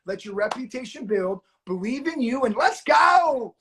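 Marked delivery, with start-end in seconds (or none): 2.4-3.5 loud, higher, drawn-out hype; big motivational send-off; rallying cry. Real, not acted. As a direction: fire up the crowd with a slow, rising shout. Rallying his students at sign-off.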